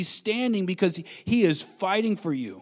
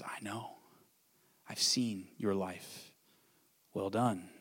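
soft tender reply; 8 semitones lower, 12 dB quieter, slower than usual. Acted. Voicing Stephen, very soft.

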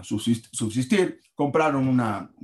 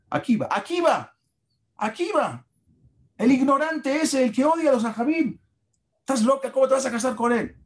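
high, outraged father yelling at his daughter; the father explodes in disbelief; angry father; 13 semitones higher than usual. Acted. Father scolds his daughter for choosing Akiva, high and outraged.

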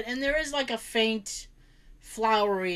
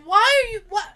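loud, high, laughing protest; laughing disbelief; 10 semitones higher, 8 dB louder than usual. Real, not acted. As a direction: sputter out a laughing what. Disbelief at mom.

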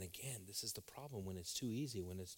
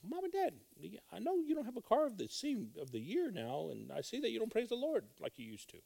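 put-on high child pleading with parents; child's voice; 13 semitones higher, 6 dB louder than usual. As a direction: voice a young kid.

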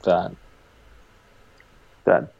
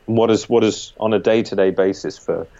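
usual speaking voice

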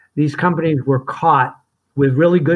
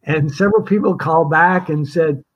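ordinary talk, nothing marked

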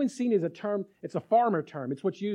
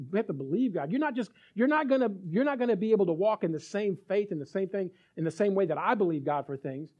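ordinary delivery

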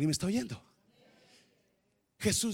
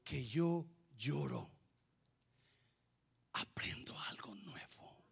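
whisper; 5 semitones lower, 13 dB quieter, slower than usual. Real, not acted. Whispered lesson.

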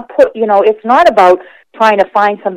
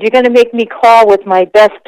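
normal talking voice

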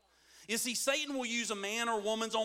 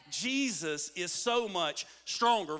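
slow, full-voiced preaching; emphatic proclamation; 3 semitones lower, slower than usual. Real, not acted. Preacher repeating the good news to the congregation, emphatic and slow. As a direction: declare it slowly with weight on STRONGER. Same words repeated with force.

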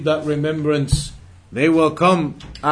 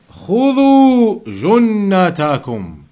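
recited Arabic; 4 semitones higher, 5 dB louder, faster than usual. Acted.

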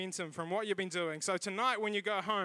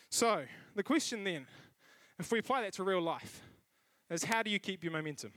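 ordinary delivery

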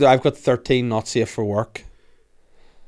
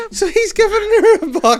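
high, loud words through giggles; laughing; 6 dB louder than usual. Real, not acted. Commenting to his friend, he keeps laughing. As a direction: speak while still laughing.

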